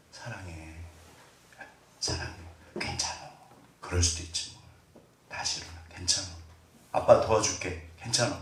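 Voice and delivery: very quiet, low tender voice; soft, gentle comfort. Shown as a demonstration. Dad consoling his child with soft words, gentle. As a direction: soothe softly, barely above a murmur. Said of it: "부드러워요".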